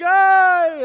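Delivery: loud, high happy hi; excited greeting. Retold or imitated. Pastor greets the children running at him, loud happy shout. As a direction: shout hello with joy.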